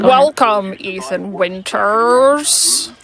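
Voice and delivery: slow, mushy villain impression; marbles in mouth. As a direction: talk as if your mouth is full of marbles. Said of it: "speak like I have marbles in my mouth".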